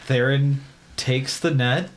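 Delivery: usual speaking voice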